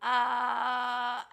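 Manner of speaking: bull-like bellow into the void; Minotaur voice. Pretend. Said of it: "Minotaur voices the void".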